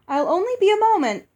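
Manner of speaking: high, loud, quick call over the shoulder. Acted. Giantess Uoxi calling back to her guests, loud and quick. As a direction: call back while hurrying off. Uoxi calls as she hurries.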